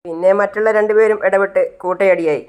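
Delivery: ordinary delivery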